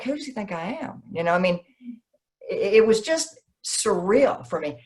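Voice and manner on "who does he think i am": high, hushed disbelief; retold incredulous aside, soft and high; baffled aside. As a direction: confide bewilderment to a friend. Retold baffled aside to her friend.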